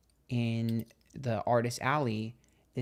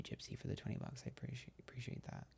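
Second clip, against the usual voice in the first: real whisper, voice dropped right out; whispered thanks; 18 dB quieter, faster than usual. Real, not acted. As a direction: breathe the thanks to the donor. Thanking a donor on stream.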